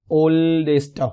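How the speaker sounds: stretched, separated beats on "ओल्डेस्ट"; syllable demo. Shown as a demonstration. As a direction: sound out "ओल्डेस्ट" in drawn-out syllables. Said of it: "ये syllable होता क्या है".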